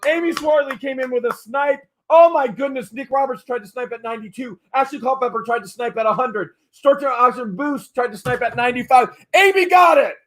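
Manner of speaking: fast, high, loud auctioneer calling the bids; rising excitement as the clock runs out; live auction play-by-play. Acted. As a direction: hype the bidding war like a live auctioneer.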